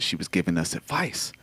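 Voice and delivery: usual speaking voice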